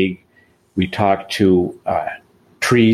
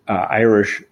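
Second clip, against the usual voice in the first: normal talking voice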